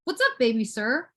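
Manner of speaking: ordinary talk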